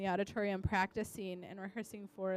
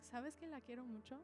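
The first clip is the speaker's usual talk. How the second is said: shy confession; 16 dB quieter, faster than usual. Retold or imitated. Shy student confesses to teacher.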